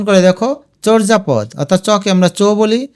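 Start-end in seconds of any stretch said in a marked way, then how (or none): none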